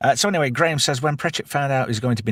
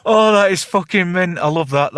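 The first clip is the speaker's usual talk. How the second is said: high, loud cackle, then the words come out laughing; helpless laughter; 9 semitones higher, 6 dB louder than usual. Real, not acted. Host reacting to a filthy chat joke, roaring with laughter. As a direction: lose it completely at the joke.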